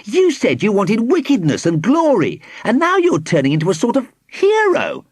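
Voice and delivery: high-pitched, incredulous accusation; accusing cry. A narrator's reading. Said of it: "he cried".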